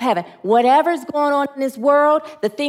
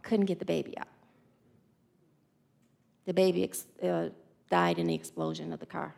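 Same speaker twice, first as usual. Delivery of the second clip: soft, choked, much lower telling of a death; grieving; 5 semitones lower, 12 dB quieter than usual. Real, not acted. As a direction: barely get the words out.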